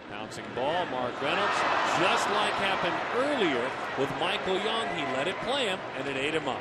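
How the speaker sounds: rising, loud live call; excited play-by-play. Real, not acted.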